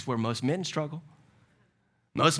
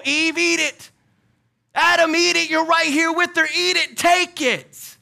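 tempting, then shouting higher and louder; coax, then yell like the serpent; 12 semitones higher, 7 dB louder than usual. Acted. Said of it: "what the serpent said to Eve".

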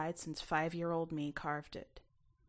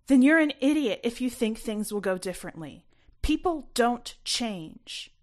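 higher and louder, cutting; angry shout; 6 semitones higher, 9 dB louder than usual. A narrator's reading. Mom shouting in the fight.

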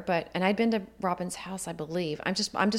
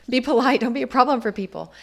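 laughing; 5 semitones higher, 9 dB louder than usual. Retold or imitated. Parental rule quoted, laughing.

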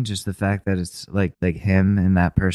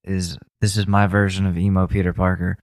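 ordinary delivery

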